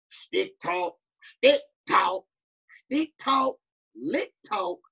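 "Stick talk" read high and put-on in a Southern coach accent; mock Southern coach. Mocking the Southern coach reading rap lyrics to the team. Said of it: "He's over here saying".

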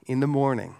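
usual speaking voice

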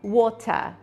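"water" in a British accent, crisp t and no final r. Shown as a demonstration. Teacher showing the British "water", crisp t and no r. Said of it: "um britânico fala water".

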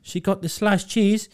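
lightly voiced eager shop worker. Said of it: "He went".

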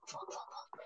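whispered panicked fuck, fuck, fuck; whispered cursing. Real, not acted. Player under fire whispering curses in real panic. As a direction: breathe the curses under your breath. Getting shot in the game.

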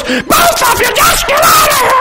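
high-pitched hammed-up shriek; imitated enraged screaming. Wife in labor at laughing husband and nurse, mock screaming. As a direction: scream both of them away.

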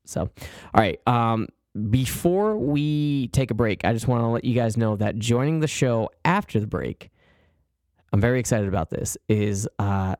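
ordinary delivery

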